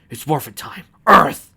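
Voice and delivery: shouting the morph call; heroic shout. Acted. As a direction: yell it like a hero. Hero shouts transformation call.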